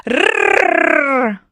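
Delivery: "rrrr" as a long rolled tongue trill; Russian R. Shown as a demonstration. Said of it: "I can do Russian R sound".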